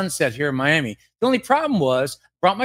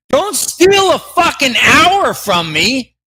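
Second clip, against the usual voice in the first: slow, louder, pleading fury; hammed-up shout; 4 semitones higher, 7 dB louder, much slower than usual. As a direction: demand it back like a robbery victim. Staged rant about stolen hour.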